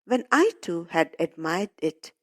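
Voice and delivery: ordinary delivery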